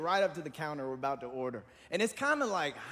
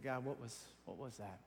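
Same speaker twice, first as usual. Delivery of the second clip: much softer, lower voice; hushed prayer; 4 semitones lower, 12 dB quieter than usual. Retold or imitated. Asking God in prayer about the day, hushed. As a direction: ask God quietly, almost to yourself.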